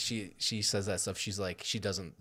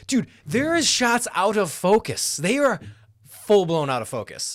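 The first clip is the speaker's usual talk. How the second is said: excited rant; 9 semitones higher, 9 dB louder than usual. Real, not acted. Ranting about out-of-focus shots.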